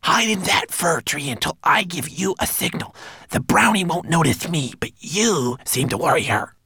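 high, breathy stage whisper; whispered orders. A narrator's reading. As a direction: conspire urgently in a little voice. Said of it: "Twigleg whispered to the bird".

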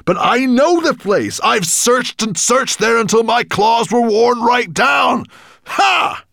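high, loud gloating shout; gloating dragon. Acted. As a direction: crow over the news, loud and high. Dragon exulting.

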